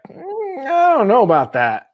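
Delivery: high, hesitant hum sliding into doubt; dubious reaction. Retold or imitated. Viewer reacting to a tasteless kids' show character, dubious.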